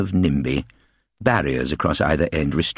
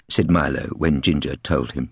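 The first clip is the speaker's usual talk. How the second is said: plain reading voice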